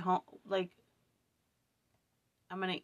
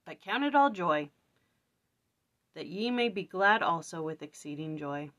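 scripture reading; 2 semitones lower, 5 dB louder than usual. Acted. Reads a scripture verse.

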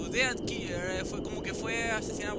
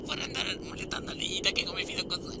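whisper; 5 semitones lower, 4 dB quieter than usual. Acted. Voz interior susurrada.

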